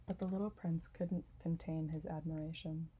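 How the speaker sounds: plain reading voice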